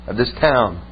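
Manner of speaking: a hint of a low, slow menacing stranger. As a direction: stranger claims to be God of the town, lightly voiced low menace. Stranger claiming to be god.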